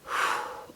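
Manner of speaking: a tired, winded exhale; weary sigh. Retold or imitated. Mom acting out her breathlessness, weary sigh. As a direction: breathe out like you're spent. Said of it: "I'm like".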